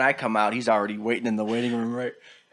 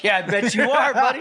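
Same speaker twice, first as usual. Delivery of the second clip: teasing laugh; 11 semitones higher, 4 dB louder than usual. Retold or imitated. Teasing sore brother.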